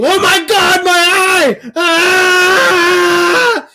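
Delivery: very high, loud hysterical shriek; mock screaming. Host mocking a protester hit by a flag, hammed-up screaming. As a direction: shriek in hammed-up agony at the top of your voice. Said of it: "she starts screaming".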